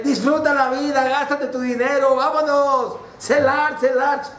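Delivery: mocking big brother urging fun; carefree brother. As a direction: tempt him to spend it all, laughing at him. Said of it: "su hermano se reía de él".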